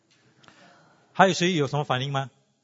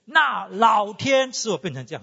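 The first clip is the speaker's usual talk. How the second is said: put-on loud, slow, higher insistent child; firm insistence; 6 semitones higher, 4 dB louder, slower than usual. Girl insists heaven made her this way, loud and slow. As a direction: declare it loud and slow, dead sure. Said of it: "他很肯定就说".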